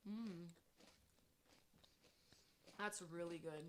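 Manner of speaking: soft, chewing while talking; words round a bite; mouth full. Real, not acted. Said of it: "I'm doing A S M R".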